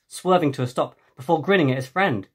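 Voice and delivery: usual speaking voice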